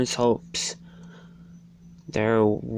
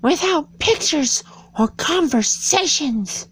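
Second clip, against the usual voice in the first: put-on much higher, louder little-girl Alice voice; high girl's voice for Alice; 4 dB louder than usual. A narrator's reading. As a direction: pitch right up as a bored young Alice. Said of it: "Thought Alice".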